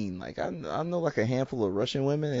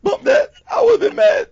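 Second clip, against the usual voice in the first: high, loud and laughing in disbelief; laughing outrage at a wasted pizza; outraged laughing; 11 dB louder than usual. Real, not acted. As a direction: squeal it out, half laughing, half outraged. Mock outrage over the date.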